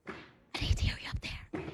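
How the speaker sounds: hushed whispered instructions. Shown as a demonstration. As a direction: whisper the cue to the room. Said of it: "whisper it".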